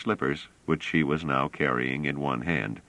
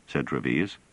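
ordinary delivery, nothing marked

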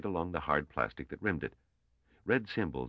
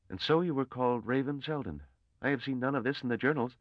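earnest young scholar, voiced much higher; a nervous young mathematician reasoning aloud, pitched up; young Gale voice; 10 semitones higher than usual. A narrator's reading. Young Gale's voice again.

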